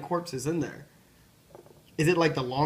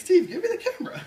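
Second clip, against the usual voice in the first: high laughing plea; laughing hard while begging; 4 dB louder than usual. Real, not acted. Laughing pleading.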